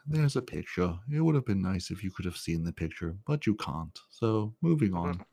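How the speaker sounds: lightly voiced soft monotone robot; soft, slow deadpan drone; Alan Rickman robot. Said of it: "that would be Alan Rickman, the robot".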